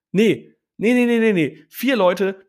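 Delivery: loud, high indignant refusal; hammed-up angry Uber driver. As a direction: mimic the driver snapping no at him. Driver angrily refusing passengers.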